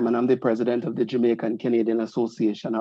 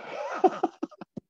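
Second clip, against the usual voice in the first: breathy amused chuckle; a quiet laugh under the breath; quiet real laugh; soft laugh; 7 dB quieter than usual. Real, not acted. Host laughing at own phrase.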